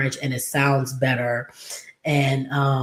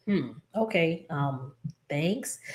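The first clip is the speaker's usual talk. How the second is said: soft, hesitant, underwhelmed mock gratitude; unimpressed thanks; 8 dB quieter, slower than usual. Maid is given her lady's old dresses, half-hearted thanks. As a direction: imitate polite but reluctant thanks, trailing softer. Acting servant's reaction to poor gift.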